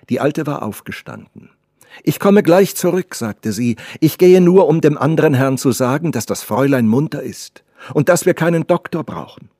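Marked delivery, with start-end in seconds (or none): 2.0-3.1 high, loud and brisk announcement; loud, lively servant; old servant announcing. Acted. Servant calls as she leaves. 4.0-9.5 high, loud, emphatic servant voice; raised, lively voice; bustling old servant. Acted. Old servant announces her errand.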